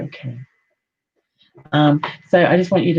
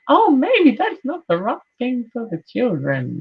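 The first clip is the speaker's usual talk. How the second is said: mock worried mum; 9 semitones higher than usual. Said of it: "Sometimes I'll be like".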